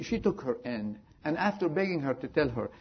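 normal talking voice

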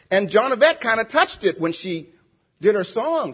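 normal talking voice

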